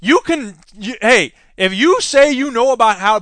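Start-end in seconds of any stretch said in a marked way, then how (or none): none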